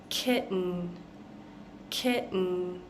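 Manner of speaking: kitten with the T swallowed, a glottal stop into a nasal n. Shown as a demonstration. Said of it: "That's how we're making this ending".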